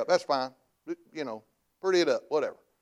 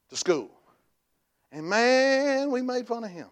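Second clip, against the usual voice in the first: usual speaking voice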